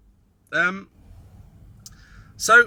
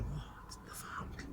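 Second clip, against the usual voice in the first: slow, hushed disbelief; inner whisper; 21 dB quieter, much slower than usual. Retold or imitated. Audience member thinking silently, whispered disbelief. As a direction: breathe it out in secret shock. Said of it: "inside they're going".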